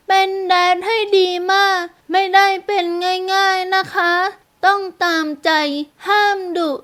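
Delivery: put-on high-pitched little girl laying down rules; small voice, measured. A narrator's reading. Girl listing rules for her would-be dad, small voice. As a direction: spell out each condition as a child. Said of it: "เสียงเล็กๆพูดช้าๆ".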